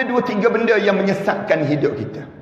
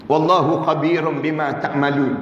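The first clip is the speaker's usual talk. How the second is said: Quranic recitation; 6 semitones lower than usual. Real, not acted.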